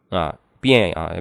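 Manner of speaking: ordinary talk, nothing marked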